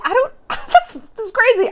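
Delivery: high-pitched feigned surprise; hammed-up disbelief; mock bewilderment. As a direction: play innocently baffled, voice way up. Feigns surprise at no longer being asked.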